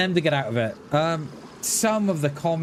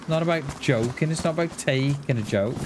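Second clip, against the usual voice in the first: ordinary talk, nothing marked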